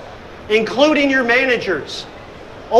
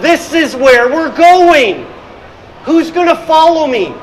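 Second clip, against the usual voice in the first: loud, slow, higher rallying cry; rallying call; 5 semitones higher, 4 dB louder than usual. Retold or imitated. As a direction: call the team to follow you. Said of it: "sometimes it's like".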